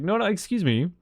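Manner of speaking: high, quick objection; interrupting. Retold or imitated. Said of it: "Welt's like".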